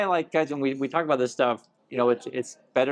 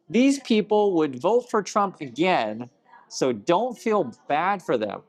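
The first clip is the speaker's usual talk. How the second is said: slow reading, pitched up; comment read-aloud; 6 semitones higher, slower than usual. Acted. Host reading Martin's comment aloud, slow and emphatic.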